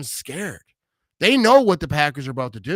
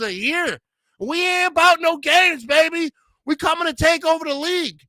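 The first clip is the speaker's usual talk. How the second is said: rising, louder, higher pep rant that peaks at the end; higher and louder with each demand, top volume on "We ain't about no games"; hype rant; 10 semitones higher, 4 dB louder than usual. Real, not acted. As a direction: fire the team up like a locker-room speech.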